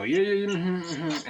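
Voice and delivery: hammed-up brush-off of a mom who won't get up; mock sleepy mom brushing off. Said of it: "or just go".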